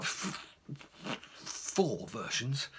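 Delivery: soft, slow, held-back "four versions" after a bitten-off F; suppressed swear. Retold or imitated. Reliving the moment he nearly swore, held back and slow. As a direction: swallow the curse word, slowly. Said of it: "take a deep breath then and not swear".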